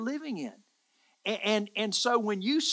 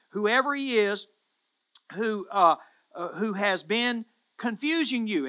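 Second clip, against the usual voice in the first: ordinary talk, nothing marked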